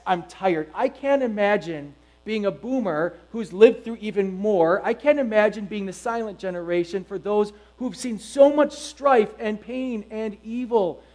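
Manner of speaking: normal talking voice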